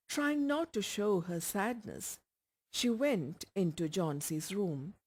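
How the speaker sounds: ordinary delivery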